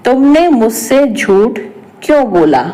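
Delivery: ordinary talk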